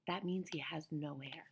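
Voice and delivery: hushed little aside; whispered aside. Real, not acted. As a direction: lean in and whisper the meaning. Aside explaining a word to kids.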